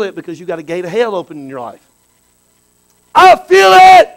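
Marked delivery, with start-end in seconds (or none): 3.1-4.1 slow, high, loud cry of faith; belting it to the congregation; shout. Real, not acted. Preacher shouting in worship.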